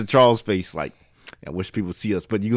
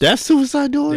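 high, slow disbelieving question; surprised. Real, not acted. Friend surprised by the definition, high and slow.

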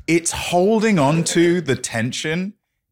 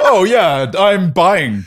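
loud, high joke delivery; hammed-up, laughing announcement; mock character voice; 5 semitones higher, 6 dB louder, much slower than usual. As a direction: belt it out as a comic disguise. Joke voice for Bing in disguise.

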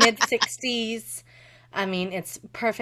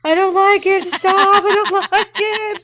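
put-on drunk whining; 10 semitones higher, 11 dB louder than usual. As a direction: wail it like a sick drunk. Replaying drunk self, high loud whine.

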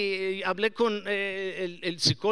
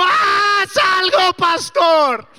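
loud, higher mock congregation shout; 10 semitones higher, 15 dB louder, much slower than usual. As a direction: shout it like the impatient crowd. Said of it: "ustedes como". (grito burlón de la congregación)

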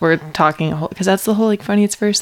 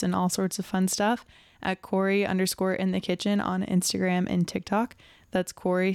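soft, quick sign-off read; podcast outro read; 11 dB quieter than usual. Acted.

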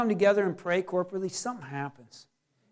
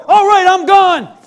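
loud, high, drawn-out eager shout; gung-ho reply; 8 semitones higher, 12 dB louder, slower than usual. Acted. Believer answering the Lord's errand, gung-ho and loud. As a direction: holler it, raring to go.

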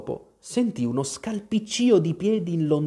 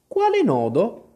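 high-pitched eager question; eager to help; 9 semitones higher, 4 dB louder than usual. A narrator's reading. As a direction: ask keenly, ready to be useful. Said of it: "pronta a rendersi utile". (domanda premurosa)

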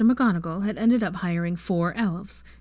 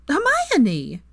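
higher, louder exasperated cry of a name; 8 semitones higher, 5 dB louder than usual. Acted. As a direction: scold her name, fed up. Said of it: "Pansy said in exasperation".

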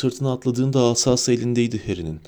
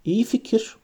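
put-on higher, nervous boyish voice; uneasy; 11 semitones higher than usual. A narrator's reading. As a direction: say it with jittery unease. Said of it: "Ron tedirginlik içinde".

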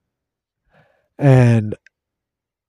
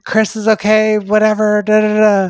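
higher-pitched mock voice for a woman brushing off worries; imitated reassuring woman; 6 semitones higher than usual. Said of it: "She's like".

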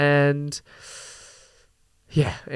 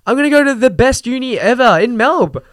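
high, excited mock student voice, rising louder; hammed-up eager student; mock naive student; 6 semitones higher, 4 dB louder than usual. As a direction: parody an eager kid dreaming of uni. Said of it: "feeding into this narrative of".

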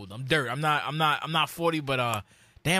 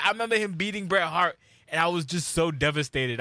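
ordinary talk, nothing marked

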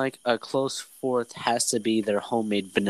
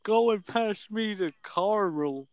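put-on table request; 6 semitones higher than usual. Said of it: "I kind of said that sentence like you".

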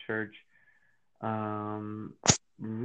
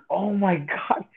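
higher, louder Oh my God; excited gasp; 8 semitones higher, 7 dB louder, slower than usual. Retold or imitated. Retelling how he freaked out over the Bible, excited exclamation. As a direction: blurt it out in awe. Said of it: "I'm freaking out".

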